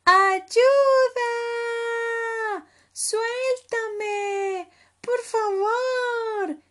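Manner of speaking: high, very loud drawn-out cries for help; long high yells; screaming. Acted. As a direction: shriek like a trapped little cub. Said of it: "gritó Leopardito".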